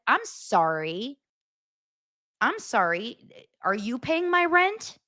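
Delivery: slow, drawn-out mock apology rising high; drawling, incredulous sass; sarcastic retort. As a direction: challenge them with a fake-sweet sorry. Sarcastic retort to complaining followers.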